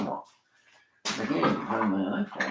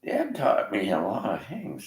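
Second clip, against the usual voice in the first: ordinary talk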